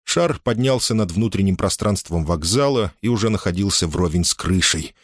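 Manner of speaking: ordinary delivery, nothing marked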